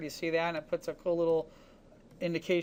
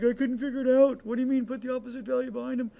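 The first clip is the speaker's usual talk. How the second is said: higher, quicker mock voice of a baffled student; confused student; 5 semitones higher, faster than usual. Teacher mocking puzzled students, higher and quicker. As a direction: play the lost student who just doesn't get it. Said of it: "students who are like".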